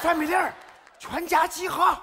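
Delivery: high, loud summons to line up; shouted order. Acted. Big brother calling the whole family to line up, loud shout. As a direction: bark it out like a drill sergeant.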